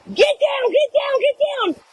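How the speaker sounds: high-pitched, urgent mock scream; retold panicked scream. Screaming out the camper door to husband, re-enacted panic. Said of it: "screaming out the door to Dave".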